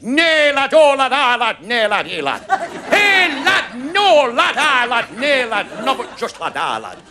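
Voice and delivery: hammed-up Northern father in dismay; imitating dad. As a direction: lament it as a stream of dialect. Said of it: "never heard my father speak to me like that".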